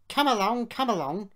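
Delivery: loud, high urging; effortful engine call; puffing. A narrator's reading. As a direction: urge the train along. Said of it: "he puffed".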